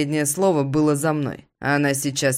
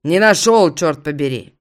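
louder irritated snap; angry; 6 dB louder than usual. Acted. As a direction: bite it back angrily. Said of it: "Злюсь я".

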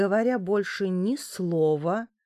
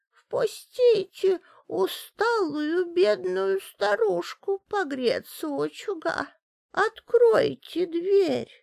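put-on weak trembling old woman; 12 semitones higher than usual. A narrator's reading. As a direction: plead at the door like a frail old woman. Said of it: "слабый дрожащий голос". (слабый дрожащий голос старушки)

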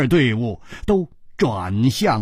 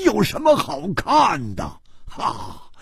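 higher, indignant outcry; much higher, incredulous Hu Tiehua; crying out indignantly; 11 semitones higher than usual. A narrator's reading. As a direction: protest at his coldness. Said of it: "胡铁花叫起来说".

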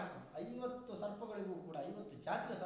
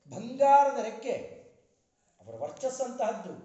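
booming, much louder praise of Garuda; full-force preaching; 3 semitones higher, 12 dB louder than usual. Acted. Preacher extolling Garuda to the audience, booming at full force. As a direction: proclaim it at the top of your voice to the hall.

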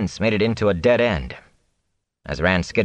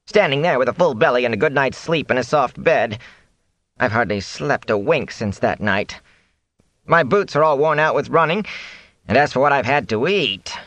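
higher-pitched grumbling complaint; whining; 6 semitones higher than usual. A narrator's reading. Fane tells Rand of his hardships, whining. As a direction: keep whining as the grievances pile up. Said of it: "Fane whined".

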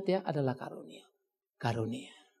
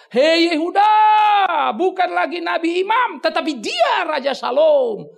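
loud, high call to Yehuda; booming prophet crying out to Judah; prophetic shout; 10 semitones higher, 9 dB louder, slower than usual. Acted. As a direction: proclaim it to the whole nation.

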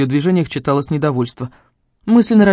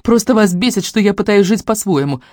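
higher, louder indignant protest; heated accusation; 6 semitones higher, 4 dB louder than usual. A narrator's reading. Daughter defending her own life, indignant. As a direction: throw the accusation at them.

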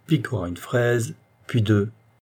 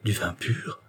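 soft, hesitant question; shy, small-voiced alarm; timid stammer; 5 dB quieter, slower than usual. A narrator's reading. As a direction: falter it out quietly. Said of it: "balbutia-t-elle".

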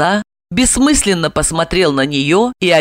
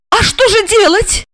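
high, alarmed female question; scared and at a loss; frightened. A narrator's reading. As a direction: ask helplessly, pitched high. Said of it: "Ольга испуганно отпрянула".

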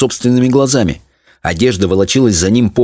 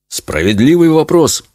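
higher-pitched, pondering father; thoughtful; 7 semitones higher than usual. A narrator's reading. Father considering his wife's question, thoughtful. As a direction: muse on it, mildly pensive. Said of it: "задумчиво произнёс отец".

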